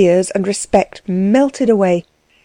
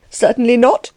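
a sharp, higher indignant denial; indignant cry; 4 semitones higher than usual. A narrator's reading. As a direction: Klutz denying drinking to Axel, higher and indignant. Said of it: "cried Klutz, the more indignantly".

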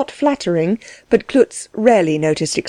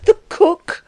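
high, breath-filled exclamation; indignant cry; 10 semitones higher than usual. A narrator's reading. Klutz throwing the word back at Delvig, indignant cry. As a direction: repeat it in high-pitched outrage. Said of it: "cried Klutz, galvanized by the word".

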